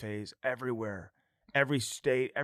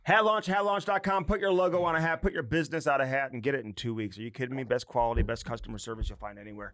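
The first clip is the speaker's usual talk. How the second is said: ad read. Acted. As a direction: sell it like a radio spot. Podcast host reads sponsor ad.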